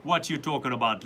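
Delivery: a light mock Jamaican accent, teasing. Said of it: "Like this?".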